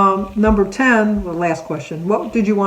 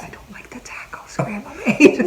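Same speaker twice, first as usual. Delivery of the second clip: put-on slow whispered kid voice; little grandkids complaining about the spicy tacos; whispered child complaint; 6 semitones higher than usual.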